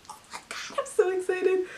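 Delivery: breathy gasp rising to a high squeal; gasp, then squeak with excitement; real excitement. Real, not acted. Vlogger reacts to her placement, gasping with excitement.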